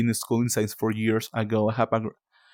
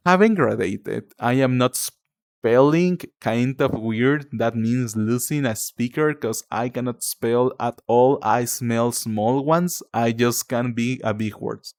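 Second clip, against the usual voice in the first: much slower, word-by-word reading; slow, deliberate reading; slower than usual. Real, not acted. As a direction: read it out slowly, puzzling through each word. Rereads puzzling comment word by word.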